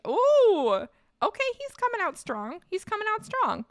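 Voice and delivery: high-pitched excited cheer, loud ooh first; impressed. Real, not acted. Streamer cheers on the candidate's strong platform, impressed. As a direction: react with a big, high ooh of approval.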